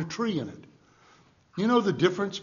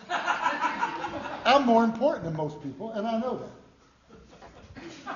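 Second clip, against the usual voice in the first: mock self-importance; 4 semitones higher, much slower than usual.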